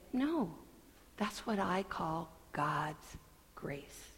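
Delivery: soft, sincere witness. Shown as a demonstration. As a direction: quietly testify it's grace. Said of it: "be a theological interpreter and say".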